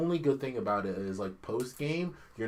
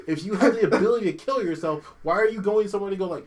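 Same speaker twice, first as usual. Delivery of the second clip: louder and higher, disbelieving; incredulous rant; 7 semitones higher, 8 dB louder than usual. Retold or imitated. Gamer yelling at the heroine in disbelief, louder and higher. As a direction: fire the question in outrage. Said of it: "And you're like".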